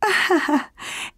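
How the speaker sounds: light laugh. Acted. Narrator laughs at Kayu's question.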